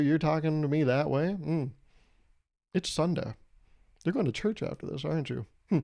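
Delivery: ordinary talk, nothing marked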